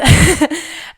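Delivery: a short high embarrassed laugh through tears; tearful laugh. Real, not acted. Grieving son laughs at his own tears, tearful laugh. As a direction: laugh it off while close to crying. Tearful talk about dad.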